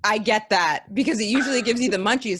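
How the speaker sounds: ordinary talk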